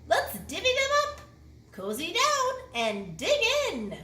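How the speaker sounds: high, loud, cheerful badger offer; grinning badger. A narrator's reading. Badger invites the friends to share nuts, grinning and loud. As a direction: share the nuts with a big grin. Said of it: "Badger says with a grin".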